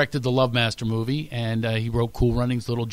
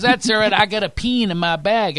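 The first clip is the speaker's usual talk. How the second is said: imitated Texan twang, raised pitch; Texas accent; 7 semitones higher, 3 dB louder than usual. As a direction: play a helpful Texan, hammed up. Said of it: "you talk about accent differences".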